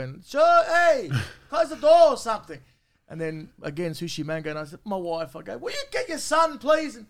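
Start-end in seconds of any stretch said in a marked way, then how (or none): 0.2-2.6 higher, louder annoyed call; exasperated shout. Retold or imitated. Dad retelling yelling at his toilet-sitting son, exasperated. Retold father annoyed at kid. 5.6-7.0 mock exasperated plea. Retold plea to wife.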